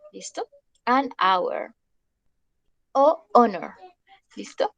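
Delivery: normal talking voice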